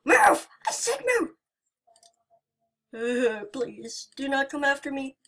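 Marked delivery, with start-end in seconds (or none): 0.1-1.4 high, loud commanding shout; angry yell. Real, not acted. Frightened player yelling at the animatronic to move. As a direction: order it out of the way, furious.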